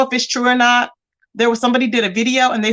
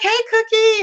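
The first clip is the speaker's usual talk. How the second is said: high, sing-song friendly greeting; sweet greeting; 12 semitones higher than usual. Retold or imitated. The man greeting Cookie, high sing-song hello. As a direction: greet her brightly and high.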